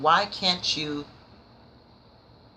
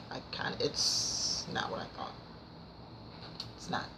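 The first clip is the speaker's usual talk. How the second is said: whispered disappointment; 4 semitones lower, 12 dB quieter than usual. Real, not acted. Disappointed verdict on the book.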